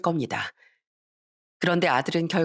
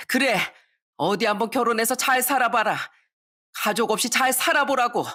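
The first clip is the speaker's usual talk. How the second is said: scolding parent with raised pitch; angry shouting; 5 semitones higher than usual. Acted. Mother disowns her son at the family meeting, angry shouting. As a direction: cry out in exasperation, voice held high. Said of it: "이렇게 소리쳤습니다".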